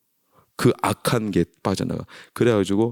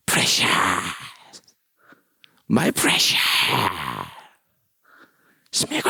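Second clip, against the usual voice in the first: creepy creature rasp; Gollum; much slower than usual. Acted. Preacher mimicking a film creature craving his treasure, creepy rasp. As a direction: croak it out, stretching the last sound. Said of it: "골룸 몰라?".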